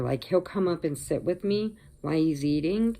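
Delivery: ordinary talk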